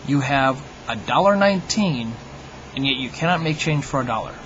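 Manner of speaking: ordinary talk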